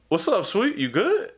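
light high mock of a smug gym guy; mocking gym bro. Gym guy brushing off the speaker, lightly mocking. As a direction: toss it off casually, a touch smug.

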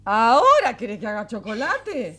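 loud, astonished question; incredulous. Acted. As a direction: burst out in disbelief at a child's request. Mother amazed by late request.

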